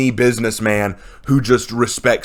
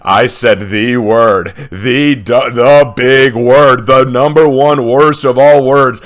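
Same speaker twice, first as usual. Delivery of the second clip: narrator voice; 7 dB louder, slower than usual. Retold or imitated. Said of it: "then the narrator".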